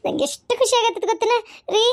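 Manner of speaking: high, crying with happiness; tearful joy. Acted. Wife overwhelmed at husband's return, crying happily. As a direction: let happy tears lift the voice. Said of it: "ಎದಕ್, ಎದಕ್ ಅಳಾಕತ್ತಿ?".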